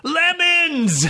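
high shouted summons; high shouted call; shouted command. Acted. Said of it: "shouted Foewind".

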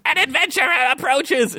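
high-pitched alarm call; skeleman's shout. A narrator's reading. Skeleton lookout warns the partying dungeon, shouting. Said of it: "shouted the Skeleman".

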